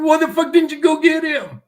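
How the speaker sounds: high, loud furious demand; a loud, angry retold shout; angry yell. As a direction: bark it at him in disbelief.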